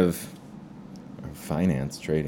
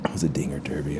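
soft unvoiced whisper; 5 semitones lower, 8 dB quieter, much faster than usual. Shown as a demonstration. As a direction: whisper it to yourself. Repeats phrase, then whispers it.

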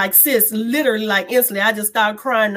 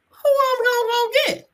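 high, strained and near tears; tearful question; 11 semitones higher than usual. Retold or imitated. Woman realizing she has no one, tearful. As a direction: voice lifting as panic sets in. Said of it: "I started crying".

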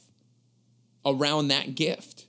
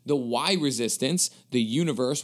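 ordinary talk

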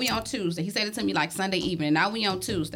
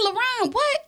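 mock shocked mom; 13 semitones higher than usual. Mother retelling son's rude call.

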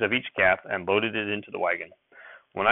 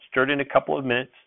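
plain reading voice

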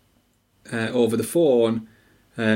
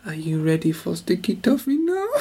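a touch of a breathy European waitress, laughing through it; mock-seductive European accent; retelling the waitress offering pudding, light European accent; sexy European accent; 5 semitones higher than usual. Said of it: "It sounded like the beginning of a porno".

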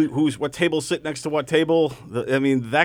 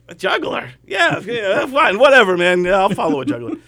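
higher-pitched dismissive brush-off; cocky arrogance; 4 semitones higher, 5 dB louder than usual. Acted. Young comic told he follows a juggler, cocky and dismissive. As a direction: play the overconfident young comic shrugging it off. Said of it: "the arrogance".

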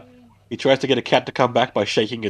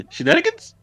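surprised question. Retold or imitated. High questioning echo.

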